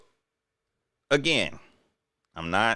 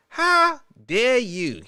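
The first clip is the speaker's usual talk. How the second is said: drawn-out, theatrical how dare you; slow mock outrage; 4 semitones higher, much slower than usual. Host fakes offense at the Mexican comment, slow and theatrical. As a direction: play the offended diva slowly.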